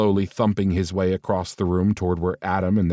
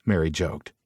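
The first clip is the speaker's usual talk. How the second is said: ordinary delivery, nothing marked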